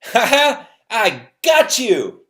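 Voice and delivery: put-on high, loud gloating cackle of a kid who landed a slap; gloating taunt. Kid taunting the wrestler after slapping him, loud high gloating. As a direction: crow it like a schoolyard victory. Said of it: "And he goes".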